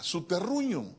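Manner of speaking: normal talking voice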